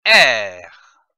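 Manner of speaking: R roulé grave et appuyé, bien tenu; son R. Shown as a demonstration. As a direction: faites vibrer le R de « R. » dans la gorge. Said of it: "Je prononce le son". (« R. » : un R guttural, grave et fort)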